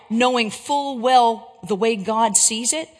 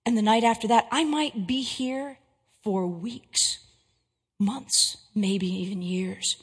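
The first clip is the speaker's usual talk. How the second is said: hushed, menacing stranger sinking to a whisper; sinister intruder; 3 semitones lower, 7 dB quieter than usual. Acted. Hulking stranger at the door asks to come in, hushed and creeping. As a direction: lure them in softly, like a predator at the door. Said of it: "And he says to you".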